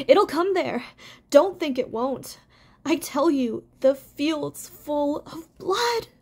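frightened insistence. A narrator's reading. Fiver insists to Hazel that danger will come, frightened. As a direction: insist in fear, dragging out the last words.

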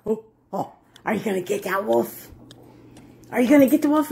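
normal talking voice

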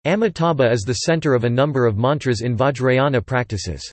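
ordinary talk

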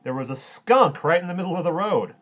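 loud, high, jovial hero voice; amused superhero. A narrator's reading. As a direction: tell the mishap with big amusement. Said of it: "said the Super Duper and laughed".

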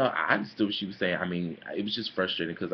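usual speaking voice

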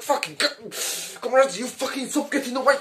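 a touch of an exasperated father yelling; mock-angry dad to his children. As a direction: scold the runaway kids half-seriously. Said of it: "I got a bit angry there".

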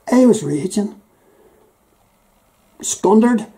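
mock outrage. Acting out taxi fare anger.

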